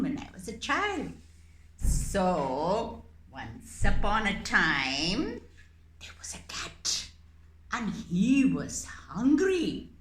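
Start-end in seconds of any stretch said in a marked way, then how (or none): 6.0-7.1 hushed storyteller opening; story whisper. Acted. Teacher starting a puppet story for kids, whispered. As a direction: whisper it like a secret.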